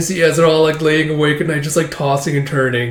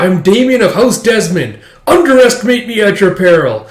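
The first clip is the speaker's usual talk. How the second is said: loud, raised hammed-up hero voice; heroic declaration; 6 semitones higher, 6 dB louder than usual. Hero announcing himself to a monster, mock heroic boast. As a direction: proclaim it like a mock knight.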